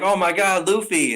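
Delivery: hammed-up delighted shock; mock surprise. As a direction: gasp out the name like meeting an old friend. Kraken recognizes Luffy.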